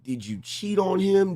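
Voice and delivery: ordinary delivery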